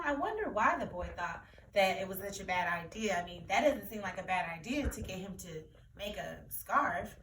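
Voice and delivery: usual speaking voice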